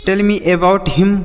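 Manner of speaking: each English word said slowly for the class; slow model sentence. Shown as a demonstration. Teacher to children, modelling an English sentence slowly. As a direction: spell it out slowly for learners. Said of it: "ठीक है".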